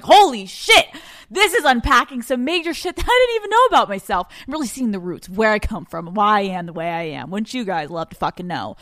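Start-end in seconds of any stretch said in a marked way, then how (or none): 0.0-4.3 stunned realization. Retold or imitated. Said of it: "I'm like".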